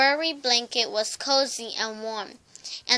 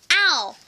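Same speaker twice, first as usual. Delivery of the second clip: high, louder yelp of ow; sharp cry of pain; 4 semitones higher, 6 dB louder than usual. A narrator's reading. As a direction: yelp at the hot soup. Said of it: "she cried".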